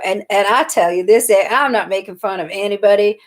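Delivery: normal talking voice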